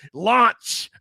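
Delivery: sudden high, loud shout; mock launch command. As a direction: yell it like a kid launching planes. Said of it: "he's, like, launching the team".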